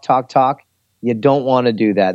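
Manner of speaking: ordinary talk, nothing marked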